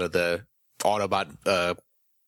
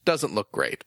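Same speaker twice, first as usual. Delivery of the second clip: ordinary delivery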